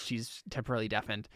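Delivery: ordinary talk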